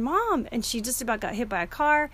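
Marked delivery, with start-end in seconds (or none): 0.0-0.5 mild mock yell, pitched high; a high mock cry of alarm; retold scream. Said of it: "and I screamed".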